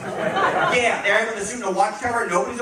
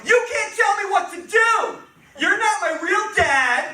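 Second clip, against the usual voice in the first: high, loud, slow defiant yelling; bratty teen shout; 12 semitones higher, 4 dB louder, slower than usual. Acted. Teenage Jesus yells back at his stepfather, high and bratty. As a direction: scream it like a sulky teenager who hates rules.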